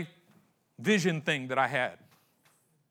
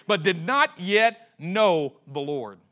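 slow emphatic refrain; 6 dB louder, much slower than usual. Acted.

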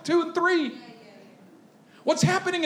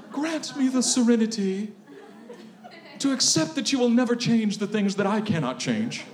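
mock serenity prayer; 5 semitones lower than usual.

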